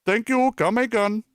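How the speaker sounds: high cartoon Indian shopkeeper accent; Apu. Streamer answering a joke about sounding like Apu, high Indian accent. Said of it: "Is that you, Apu?".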